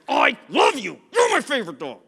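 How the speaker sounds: loud, high shouted telling-off of a dog; hammed-up angry dog-owner shout; mock scolding. Shown as a demonstration. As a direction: yell loving words as if furious. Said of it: "think that he did something wrong".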